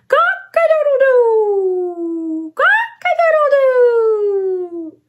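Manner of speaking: high, slow, drawn-out crowing; rooster crow. Acted. Crowing with the child like a rooster. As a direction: crow long and proud like a rooster. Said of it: "Can you crow like a rooster?".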